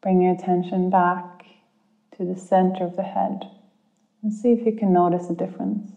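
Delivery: calm guide easing listeners inward. Acted. Leads a guided meditation.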